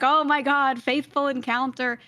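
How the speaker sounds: mock-thrilled at a chance meeting, lightly acted; retold excitement. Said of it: "and we're like".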